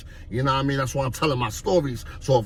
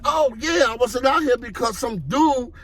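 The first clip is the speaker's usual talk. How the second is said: excited retelling; 8 semitones higher than usual. Retold or imitated. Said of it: "I'm like".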